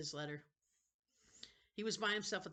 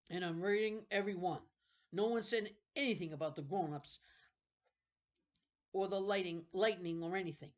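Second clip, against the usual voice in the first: Sebastian speaking; 4 semitones higher, 10 dB louder than usual. Acted. Sebastian voiced in read story.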